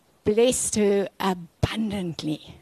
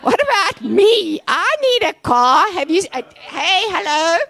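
higher and louder, hammed-up whine at God; "hey, hello?" thrown up at heaven, mock outraged; mock indignant plea to God; 9 semitones higher, 5 dB louder than usual. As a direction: play a believer griping that God forgot him. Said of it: "you go like".

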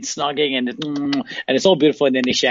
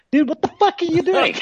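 high-pitched mock outrage; incredulous wife. Man imitating wife startled by his kissing, high-pitched disbelief. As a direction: squawk it like an appalled wife, very high.

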